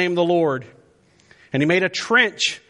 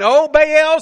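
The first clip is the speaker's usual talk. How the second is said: high, loud imploring call; calling on a god; 11 semitones higher, 8 dB louder than usual. Acted. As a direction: cry out to your god. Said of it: "called on the name of Baal".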